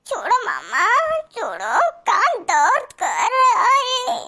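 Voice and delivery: put-on whiny child voice crying, slow. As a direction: play a little girl sobbing about her ear. Child crying, ear pulled. (रोती हुई बच्ची की आवाज़)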